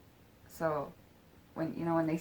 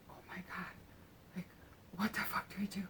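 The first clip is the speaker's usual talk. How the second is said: whispered mock panic, barely voiced; hushed shock; 10 dB quieter, faster than usual. Retelling the woman's stunned thought, hushed shock.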